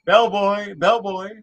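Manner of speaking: calling out for the bellboy; loud call to the front desk. Pretend. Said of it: "Let me get my hotel. The hotel bell".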